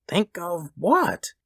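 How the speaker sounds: high, slow puzzled question; baffled husband. A narrator's reading. Husband not following his wife, baffled. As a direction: ask it slowly, bewildered.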